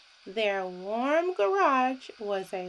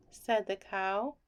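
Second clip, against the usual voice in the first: ordinary delivery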